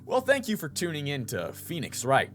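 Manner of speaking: ordinary delivery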